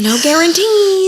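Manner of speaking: teasing. Retold or imitated. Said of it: "And I was like".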